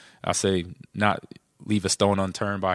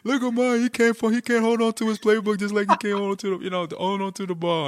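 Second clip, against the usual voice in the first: high, taunting locker-room bully voice; mock the rookie like a schoolyard bully; mocking veteran; 12 semitones higher, 3 dB louder than usual. Mocking veteran quoted in story.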